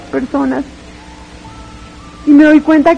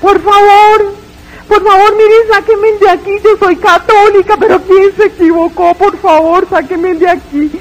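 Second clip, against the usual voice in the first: pleading cry; 5 semitones higher than usual. Retold or imitated. From the pit, crying out a second time: pleading yell. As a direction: scream for mercy again. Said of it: "empiezo a gritar de nuevo".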